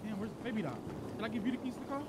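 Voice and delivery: ordinary talk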